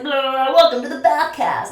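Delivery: high, loud announcer greeting; hammed-up show intro into the mic; mock podcast host. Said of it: "I just started recording a voice note and I was like".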